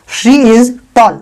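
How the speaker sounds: very slow, word by word; slow, clear dictation; slow model sentence. Shown as a demonstration. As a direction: spell it out slowly for learners. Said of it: "तो आप बोल सकते हैं".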